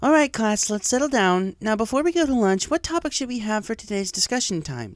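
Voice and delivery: high, bright classroom call; cheerful teacher. A narrator's reading. Cheerilee quiets her chatty class, cheerful. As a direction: settle the class down kindly.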